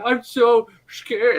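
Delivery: high, loud, drawn-out mock fright; badly faked fear. Armor still pretending as it leaks, hammed-up fright. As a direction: overact being scared, very slowly. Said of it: "starts faking and pretending".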